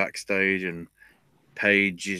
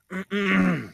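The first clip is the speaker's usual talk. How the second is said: mock throat clear; 11 semitones higher, 3 dB louder than usual.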